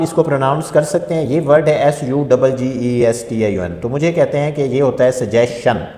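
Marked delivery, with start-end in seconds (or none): 5.1-5.9 सजेशन said with a plain sh sound, no ch; the common mistake, सजेशन with sh; wrong pronunciation. Retold or imitated. Teacher imitating students who say सजेशन with sh. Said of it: "ये तीनों wrong pronunciation है".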